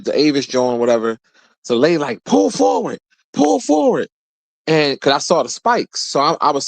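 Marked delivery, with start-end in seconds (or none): 2.2-4.1 high mock yell of an attendant; imitating yelling worker. The valet attendant yelling at him, high mock shout. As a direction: parrot the worker's barked orders in a high voice. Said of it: "like, yelling".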